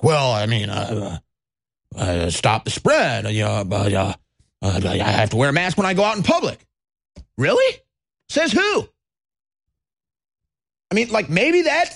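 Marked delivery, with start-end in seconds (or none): 0.0-6.6 low, halting, mumbled excuse; mock dithering. Mask wearer stammering out an excuse, mock dithering. 7.4-8.9 high-pitched scoffing challenge; mock incredulity. Host answering the mask wearer's excuse, mock incredulity.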